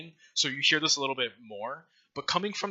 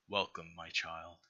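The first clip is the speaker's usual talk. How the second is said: low, slow, soft godly welcome; a lightly voiced serene deity, unhurried; god's greeting; 5 semitones lower, 8 dB quieter than usual. God greeting the dying hero, low and soft.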